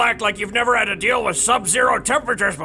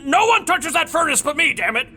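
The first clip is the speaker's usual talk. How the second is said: higher, indignant no; refuse, flaring up; defensive shout; 7 semitones higher than usual. Real, not acted. Abraxas guards his furnace.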